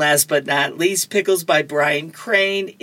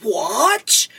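higher, startled cartoon "what"; 6 semitones higher than usual. Garfield waking up on a tree limb, startled. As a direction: wake up with a jolt of surprise. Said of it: "looks at us wide-eyed and goes".

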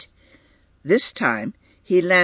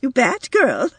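perfunctory scolding; 10 semitones higher than usual. A narrator's reading. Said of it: "she whispered perfunctorily".

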